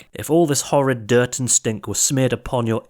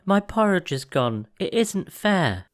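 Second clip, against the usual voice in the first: put-on baby bear whining; 9 semitones higher than usual. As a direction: drag out the unfairness like a small child. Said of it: "cried the baby bear".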